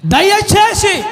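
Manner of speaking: high, urgent cry of appeal; pleading Mary. Acted. Acts Mary begging. (ఎలుగెత్తి బతిమాలుతూ)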